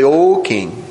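low, calm address to a king; composed, low-pitched greeting. Acted. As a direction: speak low and unhurried, at peace. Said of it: "Daniel just calmly step forward".

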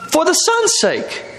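high-pitched, drawn-out disbelief; incredulous. Real, not acted. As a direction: stretch it out high, appalled at the scheme. Preacher appalled at mother's scheme.

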